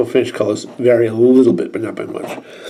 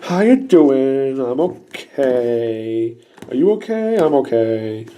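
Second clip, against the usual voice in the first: put-on slow, drawn-out Valley Girl; lazy, spacey Valley Girl chatter; hammed-up slow Valley Girl; much slower than usual. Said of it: "that Valley Girl look where he's like".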